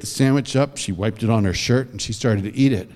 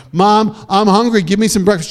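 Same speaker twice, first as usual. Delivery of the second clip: put-on insistent kid; 7 semitones higher, 4 dB louder than usual. Boy asks louder again.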